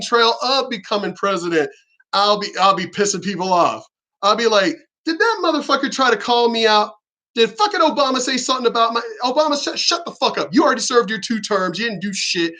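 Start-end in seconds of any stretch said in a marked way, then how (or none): 5.0-12.5 mock indignation. Would-be president telling off Obama on live stream, mock indignation. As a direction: start high in pretend outrage, ease down as it goes. Imagined president ranting at a predecessor.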